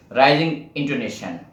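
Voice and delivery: usual speaking voice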